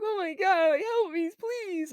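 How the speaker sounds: high, pleading kid imitation; mock crying plea. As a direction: beg like a child falling to his knees. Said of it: "he starts crying".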